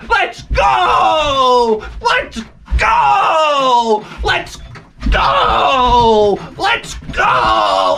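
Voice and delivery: put-on high, shouting, whiny kid; impatient kid. Son yelling at dad to leave for Wendy's, high whiny shout. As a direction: yell like a bored kid who wants to leave.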